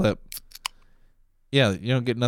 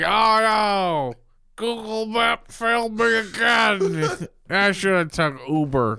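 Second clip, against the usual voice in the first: put-on high, slow, whining drunk; whiny drunk voice; 9 semitones higher, slower than usual. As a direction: whimper it out like a sorry drunk. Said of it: "He's like".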